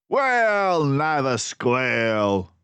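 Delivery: loud, slow defiant crooks; hoarse defiant shout. Acted. Crooks hoarsely refusing to tell Basil. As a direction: spit out the refusal. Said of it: "they shouted hoarsely".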